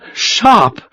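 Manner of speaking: high, startled cry of the name; the blood drains as he hears it; shocked recognition. A narrator's reading. Lieutenant hearing Sharp's name, shocked cry.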